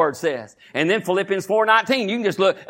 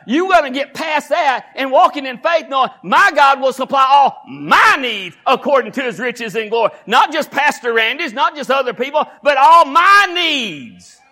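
loud, high, shouted preaching; preaching at full force; 9 semitones higher, 5 dB louder than usual. Acted. Pastor rousing his congregation about bills, shouted preaching. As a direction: build the church up, pulpit fire.

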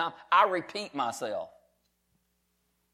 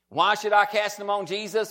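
ordinary talk, nothing marked

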